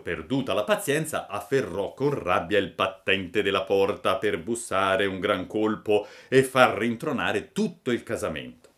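usual speaking voice

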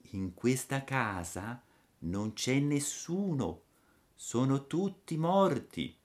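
put-on slow, soft ghostly girl; otherworldly little voice. A narrator's reading. Dead girl at the window, faint otherworldly voice. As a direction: drift the words out softly, as if from beyond. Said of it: "con una vocina che pareva venisse dall'altro mondo".

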